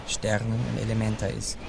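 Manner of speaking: ordinary talk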